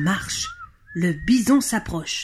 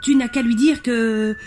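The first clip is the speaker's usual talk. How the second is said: higher, raised voice from afar; calling out; 6 semitones higher than usual. Acted. Billy in the tree calls to Jean-Claude, voice raised. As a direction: call instructions down, urgent and high. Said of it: "crie-t-il".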